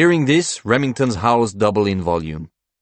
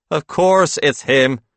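slow, raised insistence; emphatic, louder argument; remonstrating; 7 semitones higher, 6 dB louder than usual. A narrator's reading. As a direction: insist as if it were obvious. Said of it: "Paul remonstrates".

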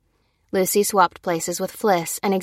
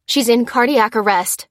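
louder, higher urgent alert; pressing, raised voice; 4 semitones higher, 6 dB louder than usual. A narrator's reading. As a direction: urge for help in an emergency. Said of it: "urged Lucy".

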